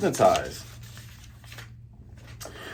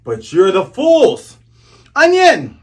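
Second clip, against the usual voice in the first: slow, loud taunt ending in a high yelled name; villain taunt; 6 semitones higher, 7 dB louder, slower than usual. Retold or imitated. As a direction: gloat then shout the name. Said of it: "the Django grabs him by the neck".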